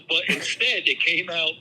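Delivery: usual speaking voice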